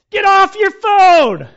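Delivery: high, loud, slow yell; angry yell. Retold or imitated. Pastor yelling at a driver on his phone, loud and slow.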